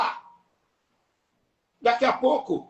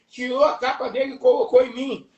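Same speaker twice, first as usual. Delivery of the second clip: ordinary talk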